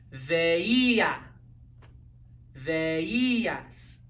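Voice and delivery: each form of Veía said with the stress on the í. Shown as a demonstration. Teacher modeling ver conjugations for students to repeat, stressed í. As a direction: lean on the í in Veía, Veías, Veíamos. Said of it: "Notice how they all have accents over the I".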